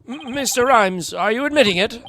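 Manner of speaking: high-pitched stammered question; startled question. Acted. Lawyer questions suspect who is admitting it, startled. Voicing game character hearing a confession.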